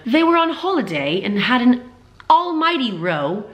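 put-on British accent. Vlogger trying out a British source's quote in a bad British accent. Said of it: "Can I try an accent?".

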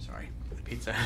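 whispering. Real, not acted. Whispered aside while eating.

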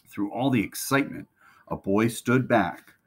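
ordinary delivery, nothing marked

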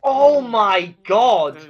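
loud, drawn-out real exclamation; shocked outburst. Real, not acted. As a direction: cry out slowly in disbelief. Shocked reaction to game event.

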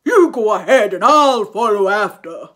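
loud big monster voice; a beast amused at the mouse; laughing Gruffalo. A narrator's reading. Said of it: "bursting with laughter".

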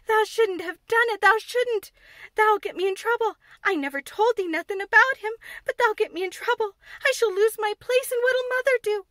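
panicked, close to tears; half crying. Acted. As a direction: maid terrified of losing her place, half crying. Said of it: "she said, half crying".

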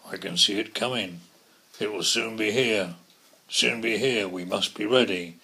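very loud announcing voice; loud calling. Acted. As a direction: call it across the farm. Donkey speaks loudly.

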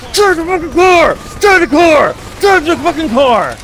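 high loud enraged shouts; furious yelling. Real, not acted. Streamer screaming at the AI driver, furious yelling. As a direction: scream the order at the useless driver.

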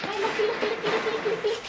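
high, soft sing-song; sing-song dog talk. Real, not acted. Tempting the dog with a treat, high sing-song. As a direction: tease him with the treat in a lilting high call.

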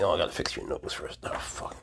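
whisper. Real, not acted. Aside while handling snake.

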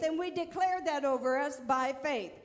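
normal talking voice

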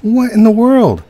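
high, loud startled cry; shocked. Real, not acted. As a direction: burst out in disbelief. Sudden shock in game.

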